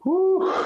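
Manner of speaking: high loud woo of strain; high effort cry. Real, not acted. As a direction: cry out at the rep's end. Finishing hard set.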